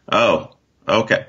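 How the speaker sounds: usual speaking voice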